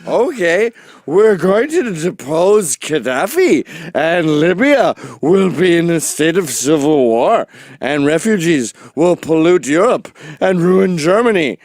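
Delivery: loud, high, overblown declaiming; hammed-up booming politician speech; mock presidential address. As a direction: proclaim it like a pompous head of state. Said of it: "you're the president of the United States".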